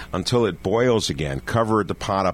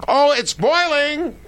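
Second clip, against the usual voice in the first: excited; slower than usual. Retold or imitated. Kid announces the pot boils.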